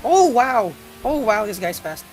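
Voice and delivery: loud oh wow; surprised exclaim. Real, not acted. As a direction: react to a fast opponent. Race game surprise.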